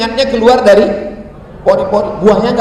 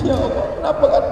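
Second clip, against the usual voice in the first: higher, softer imitation sob; mock crying; 7 semitones higher, 5 dB quieter than usual. Failed prayer leader ends up crying himself, mock crying. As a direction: whimper it in comic despair. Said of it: "akhirnya saya nangis".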